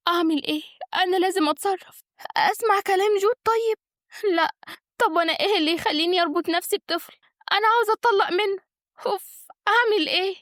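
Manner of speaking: high-pitched, agitated woman's voice; fretting young wife. Acted. Wife wonders how to get a divorce, fretting in a high voice. Figure frets alone about divorce.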